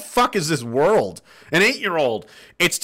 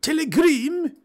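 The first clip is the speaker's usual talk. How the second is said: surprised; 13 semitones higher than usual. Real, not acted. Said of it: "You sent me a".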